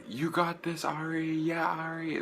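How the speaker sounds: high fangirl cheer at normal volume; imitated screaming. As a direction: mock-scream encouragement up at the stage. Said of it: "I was just screaming the entire time".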